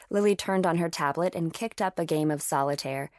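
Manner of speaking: usual speaking voice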